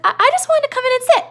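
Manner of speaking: put-on a big kid whining innocently; Lenny. Teacher voicing Lenny explaining why he came, high and childlike. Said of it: "Lenny's like".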